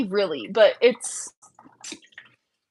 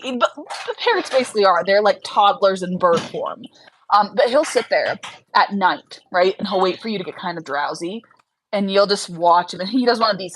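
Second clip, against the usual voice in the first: normal talking voice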